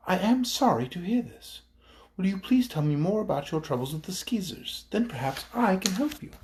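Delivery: put-on high, gentle girlish kindness; sweet and friendly. A narrator's reading. Ozma calms the angry chief, sweet and friendly. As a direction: soothe him kindly, like a gracious young princess. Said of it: "very sweetly and in a friendly voice".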